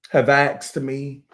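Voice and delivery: ordinary talk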